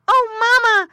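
high, loud piglet wail; squealed cry. A narrator's reading. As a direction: squeal for mother like a little pig. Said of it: "squealed Squinty".

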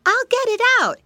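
very high, loud little-pig voice; exclaiming piglet. A narrator's reading. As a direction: exclaim eagerly as a tiny piglet. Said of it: "he exclaimed".

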